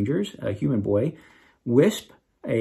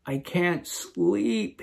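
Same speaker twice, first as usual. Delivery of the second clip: moaning; 5 semitones higher, 4 dB quieter, much slower than usual. A narrator's reading. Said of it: "he moaned".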